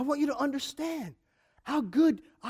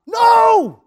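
sudden high, loud No!; shouted no; 10 semitones higher, 14 dB louder than usual. Real, not acted. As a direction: slam the objection down hard. Rejects the critic's claim.

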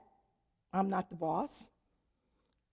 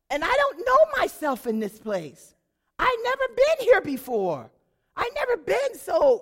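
much higher and louder, building up; rising indignation; 10 semitones higher, 8 dB louder than usual. Real, not acted. Telling listeners how headstrong she felt. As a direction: climb in pitch as the outrage grows.

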